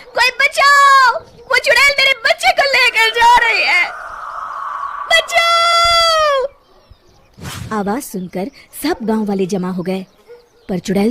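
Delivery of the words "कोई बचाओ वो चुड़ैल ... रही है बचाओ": high, loud, drawn-out screams for help; desperate scream for help. Acted. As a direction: wail for the village to stop the witch. Mother screams as witch flies off. (ऊँची, ज़ोरदार, लंबी चीख)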